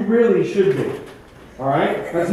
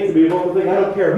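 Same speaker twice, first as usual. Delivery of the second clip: usual speaking voice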